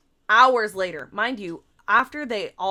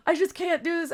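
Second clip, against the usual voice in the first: high mock-tearful plea; mock crying victim; 7 semitones higher than usual. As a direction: imitate someone playing the victim. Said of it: "like, crying and, and playing the victim".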